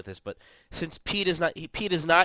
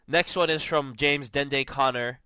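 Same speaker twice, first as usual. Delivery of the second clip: normal talking voice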